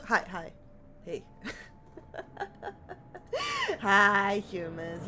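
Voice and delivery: ordinary talk, nothing marked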